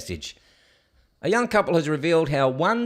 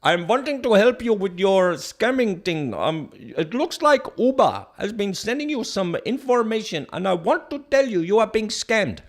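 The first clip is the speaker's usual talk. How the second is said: higher-pitched mock scammer with a put-on accent; hammed-up phone scammer voice; 5 semitones higher, 4 dB louder than usual. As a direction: play a fake call-centre worker, high and heavily accented. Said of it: "spoke to a person he believed to be a worker".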